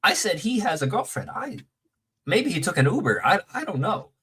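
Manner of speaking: mildly exasperated, high defensive excuse; defensive protest. Acted. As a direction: protest that it's not your fault, voice lifted. Defends himself against being called snitch.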